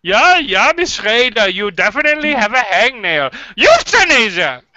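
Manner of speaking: German-accented doctor giving a mock diagnosis; cartoony German accent. Doctor diagnosing a patient's hangnail, cartoony German accent. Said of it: "the most cartoony German accent".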